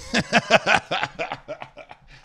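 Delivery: big high burst of laughter; loud laugh. Real, not acted. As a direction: crack up at yourself. Laughs at own rant.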